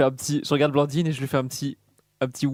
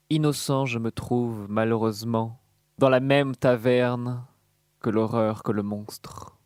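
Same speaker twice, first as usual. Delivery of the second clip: hammy stage actor. Acted. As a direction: declaim like a hammy tragedian. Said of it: "je vais déclamer un poème".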